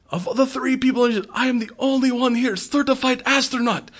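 put-on a proud Russian accent claiming he's the only astronaut; Russian cosmonaut voice. Voicing the Russian cosmonaut Lev.

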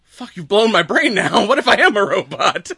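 loud, high-pitched comic meltdown; mock panic. Loki fears he might be a robot, mock panic. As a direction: act out a mind-blown freak-out, laughter creeping in. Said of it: "This is, like, the panic of".

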